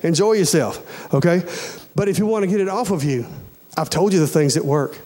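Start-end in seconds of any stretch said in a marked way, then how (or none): none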